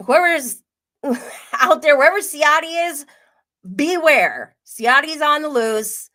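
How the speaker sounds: loud, high mock public alert; mock warning. Host warning the public that he is loose, mock alarm. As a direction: sound a hammed-up alarm to everyone listening.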